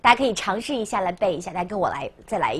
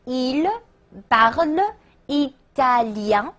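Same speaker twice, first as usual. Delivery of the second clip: French drawn out word by word, rising; slow model question; 2 semitones higher, much slower than usual. Shown as a demonstration. Teacher building an example question, slow word-by-word. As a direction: sound out each syllable slowly for learners. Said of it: "说他讲意大利语吗".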